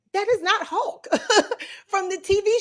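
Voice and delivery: "that is not Hulk" pitched high, rising on "is"; indignant protest. Real, not acted. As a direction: protest it like an outraged fan.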